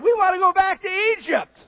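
high, loud, whining voice; whiny Israelites. Retold or imitated. Israelites whining to Moses, high mock whine. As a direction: whine like spoiled children. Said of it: "they said".

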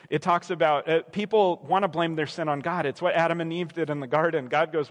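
ordinary talk

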